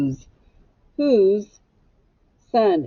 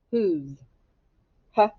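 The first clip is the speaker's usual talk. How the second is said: ordinary delivery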